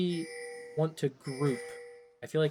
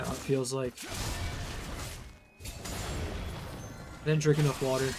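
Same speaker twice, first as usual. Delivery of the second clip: raspy, worn-out throat; hoarse voice; 7 semitones lower, 9 dB quieter than usual. Real, not acted. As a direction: talk through a dry, scratchy throat. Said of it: "old woman smoker voice right now".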